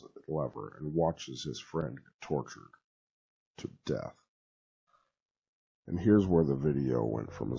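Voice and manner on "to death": ordinary delivery, nothing marked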